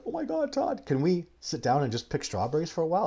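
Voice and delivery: high, begging excited voice; pleading student. Retold or imitated. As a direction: squeal like an eager kid. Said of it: "and he's like".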